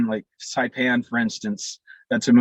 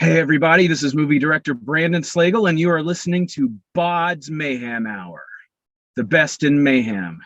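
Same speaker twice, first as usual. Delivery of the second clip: show promo; 4 semitones higher than usual. Shown as a demonstration. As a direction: hype up the podcast like an announcer. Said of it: "would you care to do a promo for my show".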